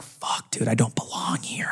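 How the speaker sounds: hushed, whispered self-doubt; whispered inner thought. Retold or imitated. Applicant to himself at the interview, whispered dismay. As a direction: whisper it as if to yourself.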